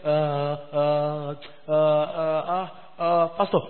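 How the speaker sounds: slow, drawn-out, mimicked hesitation; reluctant hemming. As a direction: stall the pastor with long uhs. Member hesitating to host brother.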